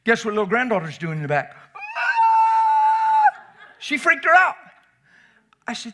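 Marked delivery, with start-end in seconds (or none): 1.7-3.4 very high loud shriek; startled scream. Retold or imitated. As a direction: shriek in fright. Imitating startled grandma.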